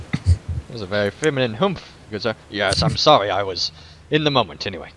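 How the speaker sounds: ordinary talk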